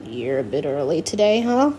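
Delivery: a guilty dad covering an awkward moment. Acted. Caught-out dad asks his daughter, sheepish and low. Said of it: "clearing his throat again".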